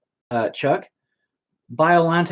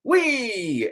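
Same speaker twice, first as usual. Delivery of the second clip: mock Americanized Japanese. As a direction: parody a Japanese visitor gushing about America, played for laughs. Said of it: "they wouldn't just come to America and be American".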